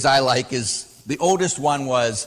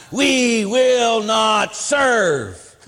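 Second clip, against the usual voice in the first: slow, higher, louder drawn-out proclamation; mock scream; 7 semitones higher, 4 dB louder, much slower than usual. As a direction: stretch each word, hammed-up podium defiance. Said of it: "she screamed".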